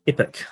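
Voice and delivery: Epic with an ee vowel, ee-pic; New Zealand pronunciation. Shown as a demonstration. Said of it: "They just change E's".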